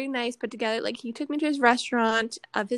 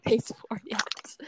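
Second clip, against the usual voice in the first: ordinary talk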